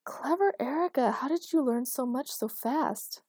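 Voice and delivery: high, delighted praise. A narrator's reading. Elena clapping for clever Erica, high and delighted. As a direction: marvel in a high, lilting voice.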